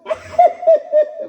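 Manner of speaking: high burst of laughter. Real, not acted. Speaker laughs at own joke.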